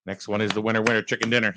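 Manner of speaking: ordinary talk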